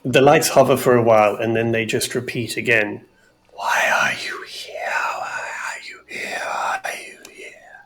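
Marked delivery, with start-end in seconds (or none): 3.5-5.0 slow eerie spirit whisper; ghostly whisper. Acted. Spirit's question. 5.2-7.8 ghostly whisper. Acted. Spirits whisper again.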